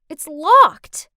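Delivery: plain reading voice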